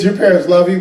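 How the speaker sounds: ordinary delivery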